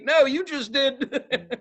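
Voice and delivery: high and drawn out, amused; laughing comeback. Retold or imitated. Answering her question about who told, laughing. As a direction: stretch it out, breaking into laughter.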